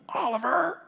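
high, loud mock call of the name; imitated yell. Preacher likening God's call to the dog chase, high yell. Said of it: "there's a God that's yelling".

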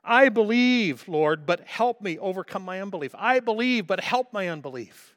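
high, loud, pleading cry; anguished plea, high and loud; desperate cry. Acted. Father's desperate cry retold.

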